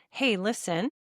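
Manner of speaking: ordinary talk, nothing marked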